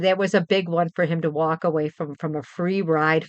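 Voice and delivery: ordinary talk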